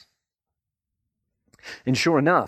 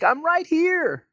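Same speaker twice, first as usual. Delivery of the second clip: very high, slow demon squeal; dumb demon; 4 dB louder, slower than usual. Acted. Mocking a demon's dumb remark, high drawn-out squeal. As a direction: squeal it like a demon giving itself away. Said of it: "dumb things a demon could say".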